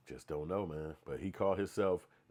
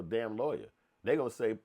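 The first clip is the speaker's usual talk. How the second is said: ordinary talk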